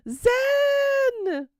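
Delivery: high, loud, delighted call; excited shout of a name. Real, not acted. Calling out a friend's name.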